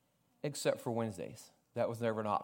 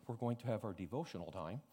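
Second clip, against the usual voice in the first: put-on softer old-fashioned take; 4 semitones lower, 11 dB quieter than usual. Shown as a demonstration. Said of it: "They said".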